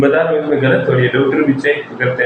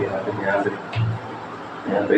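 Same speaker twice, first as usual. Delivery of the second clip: soft pointing; 4 semitones lower, 10 dB quieter, faster than usual. Real, not acted. Showing wound.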